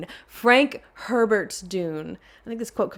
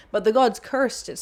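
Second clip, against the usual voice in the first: ordinary delivery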